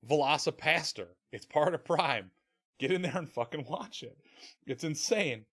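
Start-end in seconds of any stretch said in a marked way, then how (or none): none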